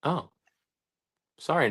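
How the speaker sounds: ordinary delivery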